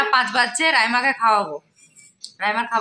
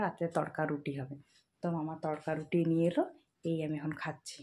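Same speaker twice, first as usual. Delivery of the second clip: hushed narration; 6 semitones lower, 14 dB quieter than usual. Real, not acted. Speaks softly while baby sleeps. (ঘুমন্ত শিশুর পাশে চাপা গলায় কথা)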